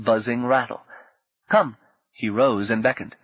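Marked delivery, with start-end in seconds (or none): 1.5-1.8 short firm call to come. A narrator's reading. Habu calling Rini to dance, a firm call. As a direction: beckon her up with a call.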